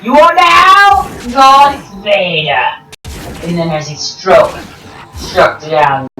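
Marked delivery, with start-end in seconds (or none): 0.0-2.8 slow, higher, grand mock proclamation; a slow, overblown Sith coronation; hammed-up Emperor. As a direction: crown him Vader, hamming it up. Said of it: "He's like".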